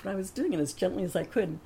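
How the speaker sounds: ordinary delivery, nothing marked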